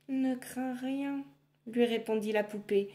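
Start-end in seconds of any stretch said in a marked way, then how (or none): 0.1-1.2 slow, higher little doll voice; lightly voiced magic doll; comforting doll. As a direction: soothe her gently and slowly.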